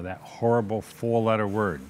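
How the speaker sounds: usual speaking voice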